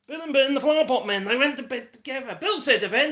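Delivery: loud, high nursery recital; sing-song rhyme. Pretend. Reciting children's rhyme mockingly.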